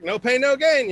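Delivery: high, loud pep-talk voice; gym coach voice. Retold or imitated. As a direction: bark it like a fitness coach. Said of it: "she's like".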